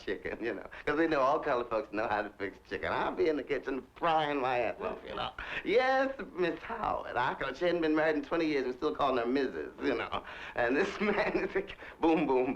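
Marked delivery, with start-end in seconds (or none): 5.6-7.2 mock servile reply. Servant answering his employer.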